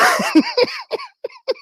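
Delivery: high burst of laughter; high wheezing laugh; helpless laughter. Real, not acted. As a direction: double up at your own joke. Cracks up at own story.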